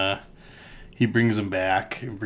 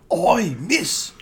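mock Cockney farrier; 13 semitones higher than usual. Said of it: "He's Australian".

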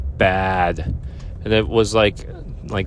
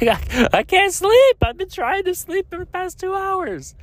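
squeaky, very high mock whine; hammed-up high whine, slowly at first; whiny self-quote. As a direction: pitch himself way up like a helpless kid. Voices himself whining to girlfriend.

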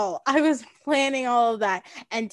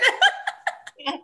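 embarrassed laugh; 7 semitones higher than usual. Real, not acted. Laughs at own wording.

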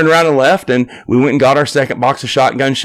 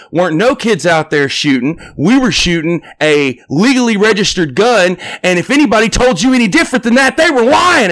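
heated retort; 8 semitones higher than usual. Retold or imitated. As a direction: rise into a defensive shout. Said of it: "cuts her off and goes".

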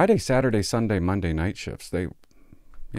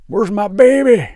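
put-on high, loud, slow drunken wail for her baby; drunk mom wail; 12 semitones higher, 10 dB louder, slower than usual. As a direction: wail like a sloppy drunk mother. Drunk mom voiced again.

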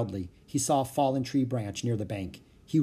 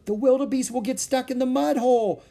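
high, loud, distressed warning; alarmed cry; 13 semitones higher, 6 dB louder than usual. A narrator's reading. Annie to Jack about the swimming wildebeest, alarmed cry. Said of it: "she cried".

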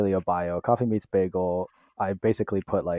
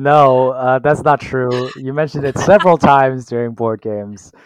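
loud, high, laughing denial; loud protest; 5 semitones higher, 11 dB louder than usual. Real, not acted. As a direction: protest it loudly, half laughing. Guest loudly denies host's claim.

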